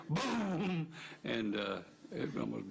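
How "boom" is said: high excited boom; boom sound effect. Retold or imitated. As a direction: blurt boom like a sonic blast. Imitating the sonic boom overhead.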